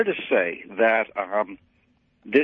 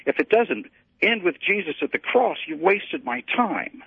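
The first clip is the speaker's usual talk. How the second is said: pointed rebuke; 9 semitones higher than usual. Retold or imitated. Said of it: "and I said".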